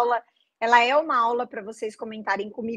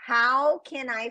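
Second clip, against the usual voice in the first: slow model phrase; slower than usual. Shown as a demonstration. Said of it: "faz comigo".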